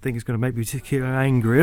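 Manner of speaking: usual speaking voice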